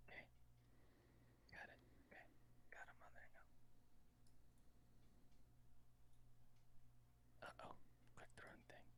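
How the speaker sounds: soft unvoiced whisper into the mic. Real, not acted. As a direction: whisper as if not to disturb anyone. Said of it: "Why are you whispering so much?".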